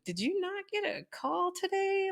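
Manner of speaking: high, laughing disbelief; stunned teacher laughing in disbelief; incredulous laugh. Retold or imitated. Teacher baffled, laughing at parent.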